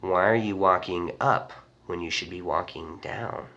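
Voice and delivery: low, soft, ominous stranger; quiet, eerie warning; creepy stranger. Retold or imitated. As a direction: imitate a menacing passerby, hushed and low. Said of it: "turns at me and goes like this".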